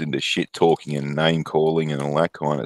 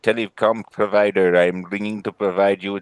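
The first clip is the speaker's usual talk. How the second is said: put-on telemarketer in an Indian accent. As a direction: put on an Indian call-centre voice. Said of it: "that was a fucking amazing Indian accent".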